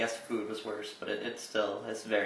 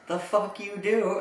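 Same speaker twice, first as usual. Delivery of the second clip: high, loud, slow joking jeer; drunk guy's jab; 10 semitones higher, 6 dB louder, slower than usual. Retold or imitated. Drunk Santa-hat customer ribbing the cart pushers, loud jeer. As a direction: tease the cart boys like a drunk jokester. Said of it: "He goes".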